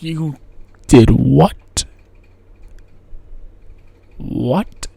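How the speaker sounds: low, loud drawn-out disbelief; mock shocked disbelief. Crowd reacting to the quarterback, mock shocked disbelief. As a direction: react like the crowd can't believe it. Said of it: "everyone's like".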